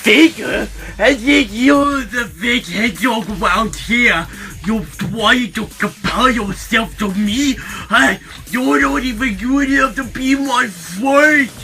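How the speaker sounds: high, loud, indignant comeback; outraged shouting. Acted. Insulted hero confronts the rival who called him faker, outraged.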